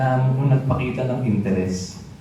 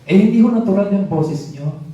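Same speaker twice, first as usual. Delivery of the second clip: higher, a bit louder, politely contradicting; polite rebuttal; 7 semitones higher, 3 dB louder than usual. Retold or imitated. Ate Bel contradicting the minister about his loud voice. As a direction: correct him firmly but respectfully.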